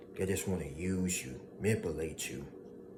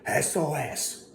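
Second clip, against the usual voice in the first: mock scream; 7 semitones higher, 3 dB louder, slower than usual.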